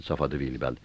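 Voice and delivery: ordinary delivery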